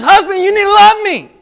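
playful mock wife, pitched high; mock demanding wife. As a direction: ham up a wife demanding love. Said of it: "she looks and says".

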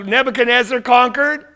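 sneering royal put-down; sarcastic. Acted. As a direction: look down on him with mock curiosity. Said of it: "in that kind of sarcastic way".

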